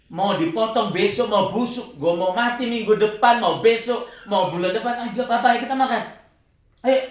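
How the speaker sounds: loud, reckless declaration to his wife; defiant outburst. Retold or imitated. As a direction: shout it out, nothing left to lose. Defiant reply to wife, shouting. (teriakan nekat kepada istri)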